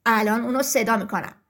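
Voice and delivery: the big rooster promising help; proud rooster. Acted.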